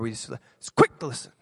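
ordinary delivery

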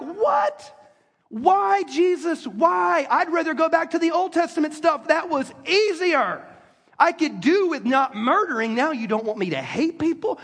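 high, outraged What? then a pleading whine; incredulous protest. Retold or imitated. Listener protests to Jesus, incredulous.

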